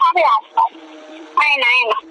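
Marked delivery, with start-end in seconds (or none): none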